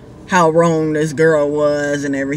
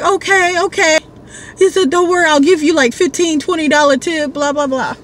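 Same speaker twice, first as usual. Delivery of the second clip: put-on eager drunk rider; 9 semitones higher than usual. Drunk rider promising a tip.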